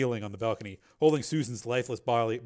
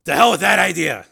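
loud, raised, defiant outburst; angry refusal; 7 semitones higher, 15 dB louder than usual. Acted. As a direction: throw the idea out angrily. Man angrily rejects her request.

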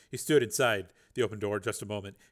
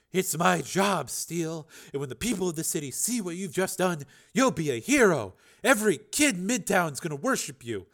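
eager reporter; 8 semitones higher, 4 dB louder than usual. Acted. Reporter voiced in dialogue.

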